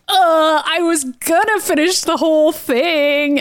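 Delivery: high, drawn-out mock whine; Kitty protesting. Kitty excusing herself to Kurt, mock indignant. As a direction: protest slowly in a high put-on voice. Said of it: "And Kitty is like".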